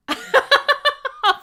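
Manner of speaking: sudden loud high laugh; high burst of laughter. Real, not acted. Host cracks up at her own joke, loud laugh.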